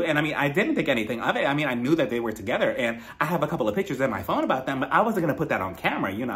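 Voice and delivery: rushed, run-on chatter; rambling fast. Acted. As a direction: gush it all out fast. Acts tipsy Courtney spilling everything.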